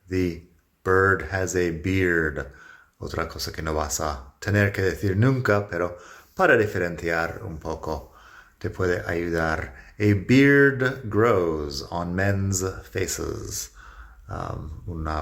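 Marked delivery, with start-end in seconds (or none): 0.1-2.5 slow, careful "The bird has a beard."; slow model sentence. Shown as a demonstration. 10.0-13.7 "A beard grows on men's faces." said very slowly; teacher dictating slowly; very slow model sentence. Shown as a demonstration.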